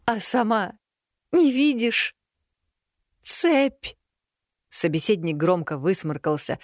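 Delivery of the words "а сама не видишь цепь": high, wounded cat voice; a tearful cat, high pitched; upset cat voice. A narrator's reading. (расстроенный кот высоким голосом)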